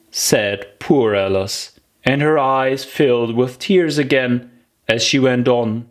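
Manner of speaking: ordinary delivery, nothing marked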